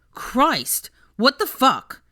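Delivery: high, loud pained outburst; shocked cry. Acted. Malcolm just punched, crying out in shock. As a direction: yelp after the punch.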